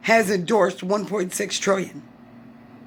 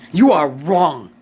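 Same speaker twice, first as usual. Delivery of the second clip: much louder, lower: You are wrong; loud accusation; 4 semitones lower, 7 dB louder, slower than usual. Real, not acted. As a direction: hammer the accusation home. Angry rant at politicians.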